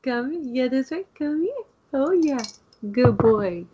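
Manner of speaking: high sing-song coaxing to a dog; pet talk. Real, not acted. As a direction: coax the dog over in a high voice.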